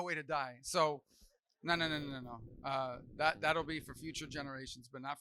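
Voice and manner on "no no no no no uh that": ordinary talk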